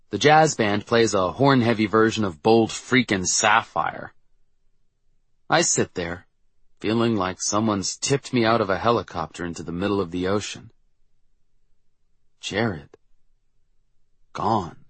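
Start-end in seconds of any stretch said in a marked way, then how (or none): none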